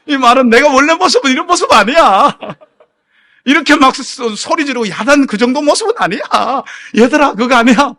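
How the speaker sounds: high, loud protesting self-defence; flustered loud excuse. Retold or imitated. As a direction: plead your case to watching youngsters. 청년들에게 당황해 해명함. (당황해서 큰 소리로 변명하듯)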